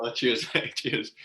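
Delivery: wry thanks with a laugh breaking through; laughing sarcastic thanks. Retold or imitated. Bowler thanking his teammate for the grim news, laughing. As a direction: chuckle through a mock-grateful reply.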